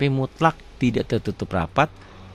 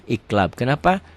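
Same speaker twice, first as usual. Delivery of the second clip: ordinary talk, nothing marked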